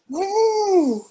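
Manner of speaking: high playful elephant "woo"; elephant trumpet. Pretend. Mom playing elephant for her toddler, playful high call.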